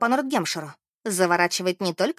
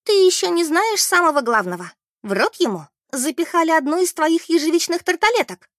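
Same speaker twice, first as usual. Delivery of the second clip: higher, louder, eager revelation; excited gossip; 8 semitones higher, 5 dB louder than usual. A narrator's reading. Beth tells Mary the most shocking detail, excitedly. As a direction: spill the juiciest news with acted excitement.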